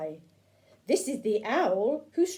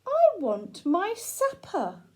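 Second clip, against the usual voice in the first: bear voice; 7 semitones higher, slower than usual. Acted. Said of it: "the bear says".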